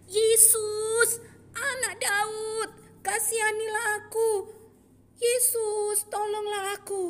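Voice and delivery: high, loud drawn-out shout for help. Acted. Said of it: "semakin kencang berteriak". (berteriak semakin kencang, tinggi dan keras)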